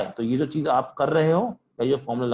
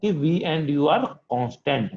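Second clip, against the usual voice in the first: very slow, gentle stress on each word; lovingly slow; much slower than usual. Retold or imitated. Teacher recalling how he stressed the condition, very slow and gentle. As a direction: spell out the key condition slowly and kindly. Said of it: "यहाँ बहुत प्यार से बोली थी".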